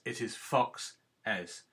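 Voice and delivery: "foxes" said slowly as fox-es; slow syllable-split foxes; two syllables, slow. Shown as a demonstration. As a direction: draw "foxes" out in two clear beats. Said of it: "There are two syllables".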